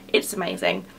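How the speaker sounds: usual speaking voice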